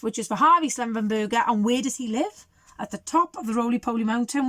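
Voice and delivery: ordinary delivery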